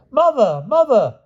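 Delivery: put-on very high, loud child's shout; boy shouting. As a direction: call out to mother in a panic. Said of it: "shouted Gareth".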